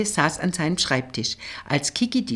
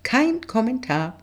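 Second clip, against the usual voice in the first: higher-pitched, mock-innocent refusal; prim little deflection, pitched up; sanctimonious brush-off; 7 semitones higher than usual. A narrator's reading. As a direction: fend off the question with false innocence. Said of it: "Scheinheilig wehrte Kiki ab".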